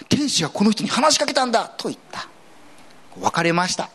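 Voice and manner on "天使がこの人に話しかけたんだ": bystander. Acted. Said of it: "他の者たちは".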